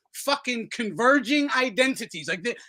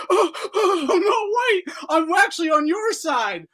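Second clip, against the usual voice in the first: higher, loud whiny mock voice; higher pleading mock of a flip-flopper; mock backpedaling; 7 semitones higher, 4 dB louder than usual. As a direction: play a coward caving in. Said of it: "you're like".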